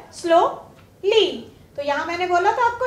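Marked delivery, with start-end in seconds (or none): 0.1-1.3 स्लोली broken into "slow", pause, "lee"; two separate syllables. Shown as a demonstration. Teacher counting syllables for the class, स्लोली split in two. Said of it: "two syllable है".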